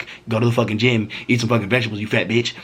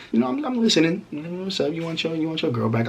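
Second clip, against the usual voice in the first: teasing pitched-up taunt; mock high voice; 7 semitones higher than usual. Mocking the ex who offers money, in a sing-song high voice. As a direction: toy with him in a raised voice.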